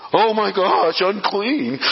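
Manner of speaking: high, loud gasp of scandal; mock-scandalised onlooker; shocked crowd. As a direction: play the gossiping crowd recoiling. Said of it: "You imagine the crowd?".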